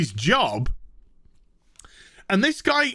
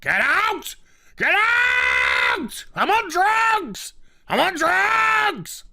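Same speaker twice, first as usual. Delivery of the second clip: hammed-up yelling, much higher and slow; mock shout; 12 semitones higher, much slower than usual. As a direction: scream it like a raving boss. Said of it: "And he shouts".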